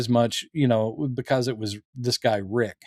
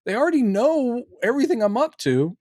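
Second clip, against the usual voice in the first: exasperated, much higher-pitched retort; Carol; 10 semitones higher than usual. Retold or imitated. Woman telling paranoid guest they already know, high exasperated voice. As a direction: pitch it way up, insisting on the obvious. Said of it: "and she's like".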